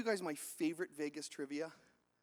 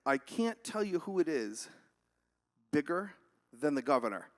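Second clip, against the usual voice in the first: guarded cop; 4 semitones lower than usual. Acted. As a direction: draw it out, dropping at the end. Cop withholding again, drawn out.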